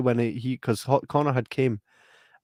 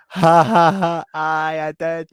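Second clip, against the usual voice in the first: a hammed-up "ha ha" laugh, louder; fake laugh; 5 semitones higher, 8 dB louder, much slower than usual. Owning up to the sister, a hammed-up laugh. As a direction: force out a smug laugh. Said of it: "this is where I'm just gonna laugh".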